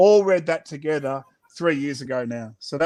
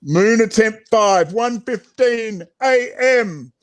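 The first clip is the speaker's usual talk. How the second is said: slow, louder mission log call; announcer log; 5 semitones higher, 4 dB louder, slower than usual. Acted. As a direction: announce the attempt like a space mission. Announcing attempt like countdown.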